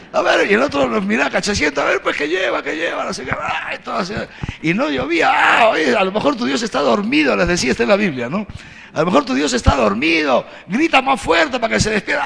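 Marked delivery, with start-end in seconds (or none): 1.8-3.7 high sing-song parody of their babble; mocking imitation. Elijah mocking the prophets' chant, high and scornful. 5.3-7.4 high, quick sarcastic jab; sarcastic jibe. Acted. Elijah mocking the prophets that their god sleeps. 8.9-12.2 high, raised mocking call; sarcastic jibe. Elijah mocking the prophets to shout louder, high and sarcastic.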